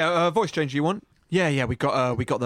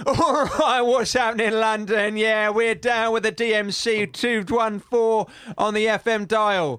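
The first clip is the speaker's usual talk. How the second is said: higher-pitched, hyped-up radio presenter; hip London DJ; 4 semitones higher than usual. Acted. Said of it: "just something hip".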